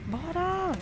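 high, eager "let's go"; excited cheer. Real, not acted. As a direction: shout it out with excitement. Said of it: "eu tô bem animada".

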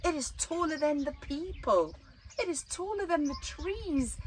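rising storyteller awe; building excitement. Acted. Building wonder at size.

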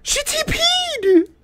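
high shrieking shock; shocked scream. Real, not acted. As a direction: yell out in sudden panic. Monster teleports at her.

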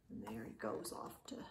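soft unvoiced whisper; whispered aside to listeners. Real, not acted. Aside while reading bedtime story.

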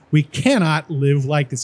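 slow, with "cannot" pressed hard; stress on cannot. Shown as a demonstration. Demonstrating emphasis for the lesson, slow with "cannot" stressed. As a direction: drag it out and hit "cannot". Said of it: "using verbal stress just like I said".

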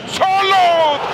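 screaming the name at full force; screaming football commentator. Real, not acted. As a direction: yell it out for the goal. Goal call, commentator screaming name.

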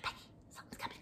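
very soft scared whisper. Retold or imitated. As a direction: whisper a warning to a friend. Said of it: "I was like".

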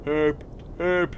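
silly voice. Pretend. Silly high herp voice.

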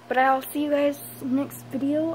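usual speaking voice

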